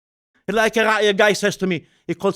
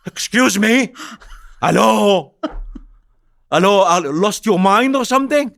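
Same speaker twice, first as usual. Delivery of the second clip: loud, high incredulous outburst; mock disbelief; 5 semitones higher, 7 dB louder than usual. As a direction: call out as if they've lost it. Mocking a position he finds absurd.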